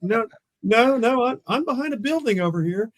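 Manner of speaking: high, insistent denial; no no, I'm hidden; protesting. Retold or imitated. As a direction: brush off the warning, high and quick. Protesting to partner on phone.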